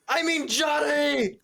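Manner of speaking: high, slow call upward; yelling up. Acted. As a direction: call out to a giant far above. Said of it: "look up at Johnny and yell".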